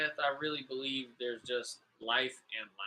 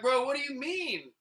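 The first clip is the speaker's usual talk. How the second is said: much higher, baffled bro question; retold disbelief on the window ledge; incredulous question; 10 semitones higher than usual.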